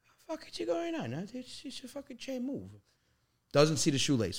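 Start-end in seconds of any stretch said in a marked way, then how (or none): 0.0-2.7 hushed, high-pitched imitation of dad; baffled dad. Son imitates dad searching the kitchen, softly bewildered. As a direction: puzzle aloud softly in a high voice. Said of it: "He goes".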